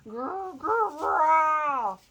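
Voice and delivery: big, loud rumbling growl. Acted. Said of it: "The growling got louder and louder".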